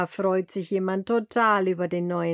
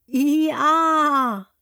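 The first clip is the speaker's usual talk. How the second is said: high, loud hee-haw; donkey bray; 7 semitones higher, 7 dB louder than usual. Shown as a demonstration. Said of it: "wie ein Esel macht?".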